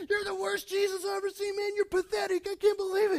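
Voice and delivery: heckler jeering. Retold or imitated. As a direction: taunt the actor from the crowd. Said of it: "He goes".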